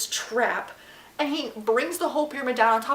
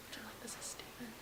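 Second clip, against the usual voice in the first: whisper; 27 dB quieter than usual. Real, not acted. Aside while friend is on phone.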